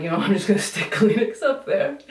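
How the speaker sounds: amused retelling with a laugh breaking in; laughing self-quote. Retold or imitated. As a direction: quote yourself through a laugh, pitch lifted. Laughs retelling her nose fix.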